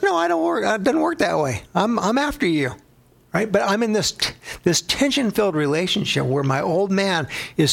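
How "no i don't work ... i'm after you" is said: higher, mock-insistent reply; Jesus insisting. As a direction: playfully refuse to be brushed off. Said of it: "It's like".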